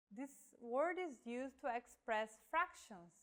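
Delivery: usual speaking voice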